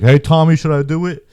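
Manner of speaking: put-on high, loud drunk buddy yelling across the bathroom; friend's loud hyped call. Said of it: "but he was like".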